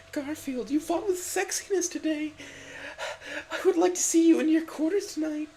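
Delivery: woo him in a swooning voice; mock sultry dreaminess; dreamy. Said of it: "said Miranda dreamily".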